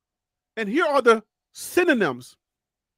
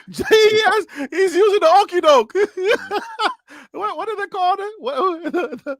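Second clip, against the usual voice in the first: laughing; 7 semitones higher than usual. Real, not acted. Laughing at guest dodging.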